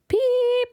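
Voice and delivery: very high whistle; whistle beep. A narrator's reading. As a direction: blow the guard's whistle. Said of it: "whistled the guard".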